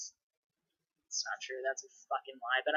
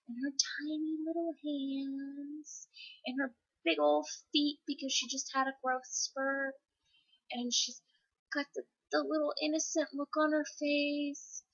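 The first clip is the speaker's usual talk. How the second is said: tender cooing; 7 semitones higher, 6 dB quieter than usual. Real, not acted. Gushing over her sleeping child.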